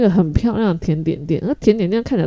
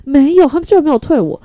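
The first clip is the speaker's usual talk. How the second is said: higher and louder, indignant; outraged surprise; 9 semitones higher, 7 dB louder than usual. Real, not acted. Telling viewers the phone didn't refund her, higher and louder in disbelief. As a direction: still can't believe it.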